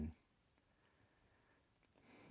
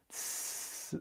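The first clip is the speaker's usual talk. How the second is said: short breathy tyranid hiss; soft mock creature hiss; alien hiss; 12 dB quieter than usual. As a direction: hiss like a bug alien with no words. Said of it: "it's just gonna be hissing".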